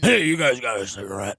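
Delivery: low rough-edged character voice; low Tina voice. Retold or imitated. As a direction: beg for a smoke, slumped over. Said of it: "my Tina character".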